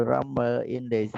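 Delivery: ordinary talk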